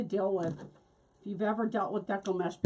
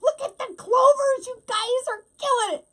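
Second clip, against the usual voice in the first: excited; 10 dB louder than usual. Real, not acted. Reacting to clover comments.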